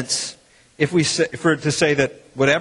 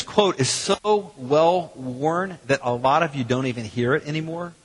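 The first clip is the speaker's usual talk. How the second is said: ordinary talk, nothing marked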